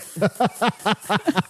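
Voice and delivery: staccato cackling fit, acted out; hammed-up hysterical laughing; uncontrollable laughter. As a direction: laugh helplessly, like someone under a spell. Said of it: "uncontrollably. He's like, he's just like".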